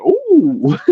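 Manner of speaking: high-pitched drawn ooh; retold alarmed ooh. Man replaying his look at his wounded arm, high ooh. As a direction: act out a startled ooh at an injury.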